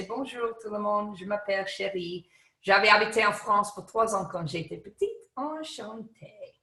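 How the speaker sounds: fluent French greeting; spoken French. Shown as a demonstration. Speaker demonstrating French to the audience, a fluent French greeting. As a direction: introduce yourself in French. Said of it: "suddenly speak French and you say".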